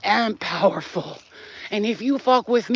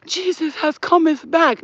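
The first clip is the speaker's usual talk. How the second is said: mock awe; 7 semitones higher, slower than usual.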